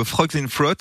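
slow, careful English accent on the band name. Shown as a demonstration. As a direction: show off an English accent, drawn out. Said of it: "mon meilleur accent anglais".

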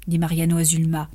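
usual speaking voice

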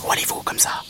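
whispered praying mantis question; toute petite voix. Acted. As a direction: ask it in a tiny, voiceless whisper. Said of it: "une toute petite voix". (toute petite voix chuchotée de mante)

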